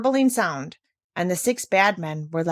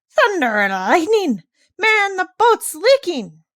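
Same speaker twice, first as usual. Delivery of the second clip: loud, slow, higher alarmed bellow; bellowing pirate; 11 semitones higher, 6 dB louder, slower than usual. A narrator's reading. Pirate captain discovering his boat leaks, bellowing. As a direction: bellow in shock at the leak. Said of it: "suddenly bellowed out".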